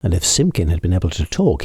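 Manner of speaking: plain reading voice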